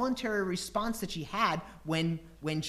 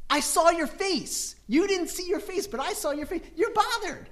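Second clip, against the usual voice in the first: insistent; 11 semitones higher, 4 dB louder than usual. Retold or imitated. Husband pressing his wife Priscilla that she is bothered, high and emphatic. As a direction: press her, pointed and insistent. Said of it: "I'll say something like".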